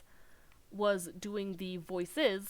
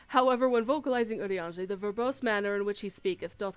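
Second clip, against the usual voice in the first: a hint of ornate archaic eloquence, louder; Urianger's verbose manner; 7 dB louder than usual. Streamer mocking Urianger's flowery speech, a touch grand and louder. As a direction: speak in grand old-fashioned style. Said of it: "vocalizing Urianger the verbose manner which he speaketh".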